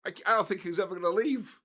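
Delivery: laughing disbelief. Retold or imitated. Retelling to Pete that the guest won't leave, high and fast. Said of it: "I said".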